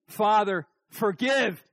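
loud, punched plea, word by word; forceful opening of a prayer; emphatic prayer. Shown as a demonstration. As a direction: call it out firmly and high. Prayer demo, punched first half.

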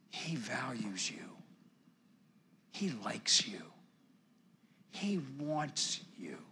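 hushed, slow, near whisper; intimate, reverent hush; tender reassurance. Real, not acted. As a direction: reassure them softly, one short phrase at a time. Tender assurance of God's love.

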